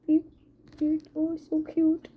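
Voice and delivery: high, gushing coo at the plant. Real, not acted. Talking to the cactus, gushing high coo.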